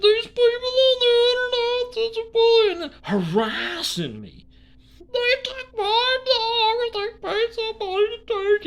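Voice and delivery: very high, loud whining; mock Square. Square telling cops the internet took his dog, high whine. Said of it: "He called the cops".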